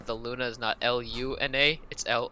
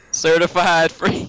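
hyped; 5 semitones higher, 10 dB louder, slower than usual. Real, not acted. Hyped joke chant.

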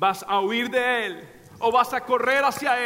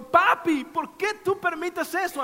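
a hint of a crying child's voice, higher; child's crying plea; 8 semitones higher than usual. Sick son crying to his father, mildly voiced high plea. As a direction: plead as the little boy through tears. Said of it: "mientras lloraba y diciendo".

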